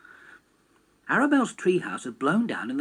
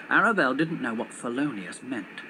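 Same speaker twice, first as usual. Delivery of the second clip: ordinary delivery, nothing marked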